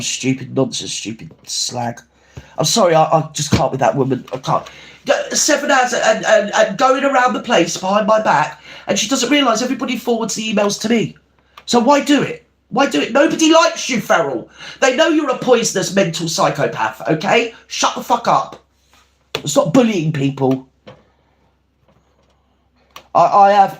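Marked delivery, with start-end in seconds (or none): 0.0-2.0 much softer, slow, dropped under the breath; muttered insult. Real, not acted. Vlogger mocking the foil woman, muttered insult. 5.1-20.7 much higher, heated, rising to a snap; furious rant. Real, not acted. Vlogger addressing troll Feral directly, furious rant.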